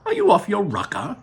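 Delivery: high, startled Wonka voice; shocked Wonka. Acted. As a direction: blurt it out, taken aback.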